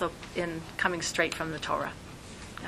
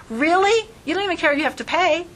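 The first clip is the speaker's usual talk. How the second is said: delighted surprise; 8 semitones higher than usual. Retold or imitated. Surprised customer at cleaners.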